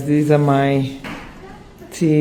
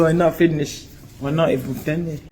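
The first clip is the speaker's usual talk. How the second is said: ordinary delivery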